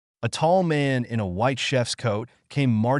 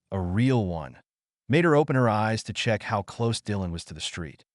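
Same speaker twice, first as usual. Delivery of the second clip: usual speaking voice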